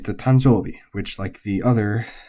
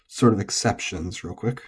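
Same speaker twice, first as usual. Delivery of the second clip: normal talking voice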